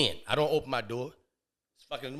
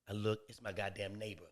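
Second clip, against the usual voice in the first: ordinary delivery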